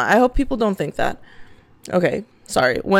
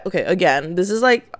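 ordinary talk, nothing marked

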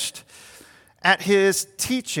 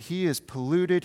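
ordinary talk

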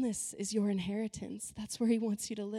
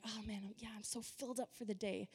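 mock contentment; 12 dB quieter than usual. Churchgoer after a good worship time, softly contented, imitated. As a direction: drop right down into a contented murmur.

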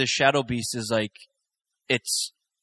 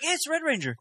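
high, soft gleeful squeal of recognition; thrilled fanboy squeal, pitched way up; excited recognition; 6 dB quieter, faster than usual. Retold or imitated. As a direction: point at the screen like a delighted kid. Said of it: "I'm like".

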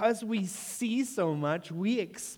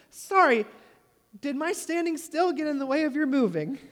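sarcastic apology; 6 semitones higher, 4 dB louder than usual. Retold or imitated. Sarcastic comeback to the lady.

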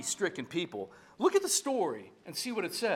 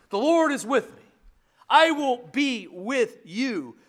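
loud, higher, slow proclamation; proclaiming; 6 semitones higher, 9 dB louder, slower than usual. Acted. Preacher proclaiming the Psalms' promise, loud and slow.

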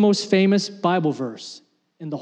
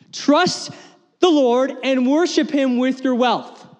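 high, drawn-out opening word; 1 fervent preaching; 7 semitones higher, slower than usual. Real, not acted. Pastor restating the verse to the church, fervent. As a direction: lift Trust up and lean into it. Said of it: "paraphrase preached high".

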